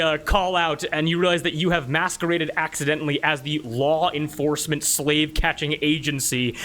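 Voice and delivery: ordinary talk, nothing marked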